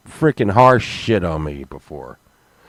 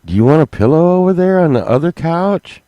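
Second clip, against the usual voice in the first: sly offer; 8 semitones higher, 6 dB louder than usual. Retold or imitated. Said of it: "And then I'm like".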